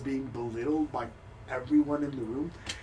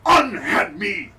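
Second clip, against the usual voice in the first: indignant outburst; 6 semitones higher, 6 dB louder than usual. Acted. Tripped noble shouting at the cleric to let go, indignant. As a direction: shake off the helper in outrage. NPC angrily shaking off helper.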